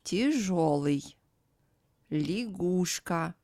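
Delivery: slow "Тяжёлый, лягушка" with first vowels as ee; tee-ZHO-ly for Тяжёлый, lee-GOOSH-ka for лягушка, drawn out; unstressed Я as И. Shown as a demonstration. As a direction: slowly model Я as И in "Тяжёлый, лягушка". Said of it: "is pronounced as И".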